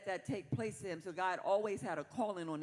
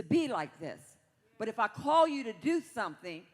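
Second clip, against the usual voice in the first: louder, punching each line; full-force preaching; 3 semitones higher, 6 dB louder than usual. Acted. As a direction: hammer it home like a preacher.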